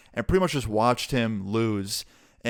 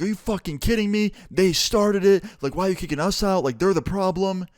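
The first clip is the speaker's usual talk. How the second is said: freaking out; 8 semitones higher than usual. Retold or imitated. Kid kicked out of the mall yelling at the cop, outraged. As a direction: protest the unfairness at the top of your voice. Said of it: "starts freaking out".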